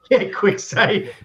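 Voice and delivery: slow, loud laugh through the words. Real, not acted. Laughing at a quick save.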